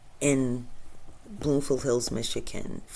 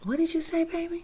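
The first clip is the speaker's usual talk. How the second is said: surprised reply. Retold or imitated. Retold reply to niece.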